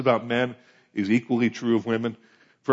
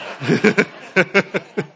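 loud, higher-pitched chuckling laugh; 5 semitones higher, 5 dB louder than usual. Real, not acted. Preacher amused at his equal rights speech, laughing loudly. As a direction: laugh out loud at your own little speech.

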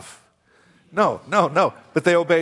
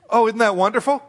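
ordinary delivery

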